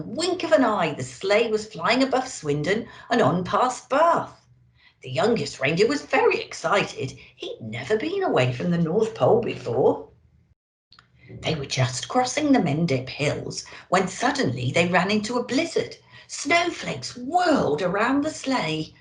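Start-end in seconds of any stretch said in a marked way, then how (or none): none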